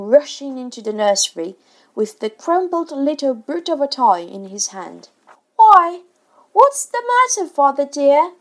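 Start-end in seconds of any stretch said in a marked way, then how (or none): 5.6-8.3 very high, loud startled question; sweetly startled, voice pitched way up; concerned surprise. A narrator's reading. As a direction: ask father in high-pitched alarm. Family startled by father's rushed entrance.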